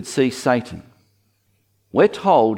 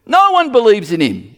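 high, insistent comic protest; comic blustering dismissal; mock Pharisee denial; 8 semitones higher, 4 dB louder than usual.

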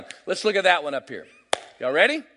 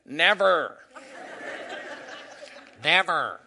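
mock American accent; 2 semitones lower, 4 dB quieter, slower than usual. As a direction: imitate Australians attempting an American accent. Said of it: "No, say it like me".

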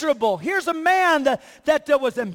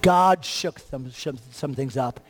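much lower, slow and drawn out; slow preaching close; 11 semitones lower than usual. Acted. Pastor closing the sermon, slow and low. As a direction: wind the sermon down slowly, low.

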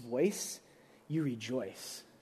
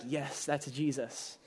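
soft, slow, low agreement; acted murmur of recognition; quiet recognition; 4 semitones lower, 8 dB quieter, slower than usual. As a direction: voice a hushed, slow assent. Voices listeners' quiet agreement.

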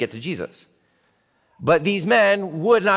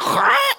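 mock record scratch; 4 dB louder than usual. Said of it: "screeching record sound".

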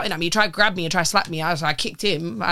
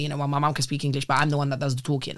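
normal talking voice

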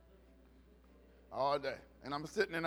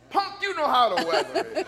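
loud, high-pitched mock outburst; angry comeback; 8 semitones higher, 12 dB louder than usual. Preacher snapping back at friends who ask about weather, mock-angry. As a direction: blow up in comic exasperation. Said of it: "Why you get so angry".

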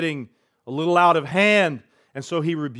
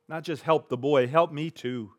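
normal talking voice

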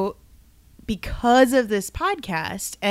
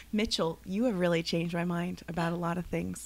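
mock tender; 3 semitones lower, 7 dB quieter than usual. Doctor's tender thanks, laughing.

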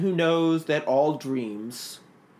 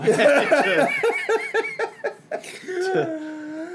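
high real laughter; can't stop laughing; 3 dB louder than usual. Real, not acted. Laughing at the cue joke.

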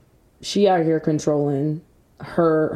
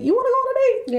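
much higher, giddy offer; squeaky excited voice; faster than usual. Pretend. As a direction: squeal it out eagerly. Eager date offers repeated.

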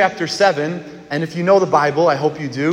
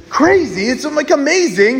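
excited; 8 semitones higher, 4 dB louder than usual. Real, not acted.

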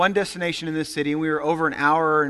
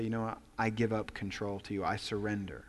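low, soft, giving in; soft sincere prayer at the altar; quiet surrender prayer; 7 semitones lower, 12 dB quieter than usual. Retold or imitated. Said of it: "You'd say".

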